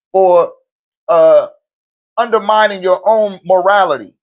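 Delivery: normal talking voice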